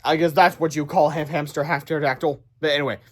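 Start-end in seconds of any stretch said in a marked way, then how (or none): none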